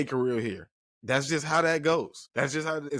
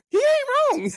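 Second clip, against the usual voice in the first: squealing high; 6 dB louder than usual. Real, not acted. Vlogger conceding a rival's point, squealing high. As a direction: voice leaps up high and slow, half laughing. Laughing admission, voice jumps high.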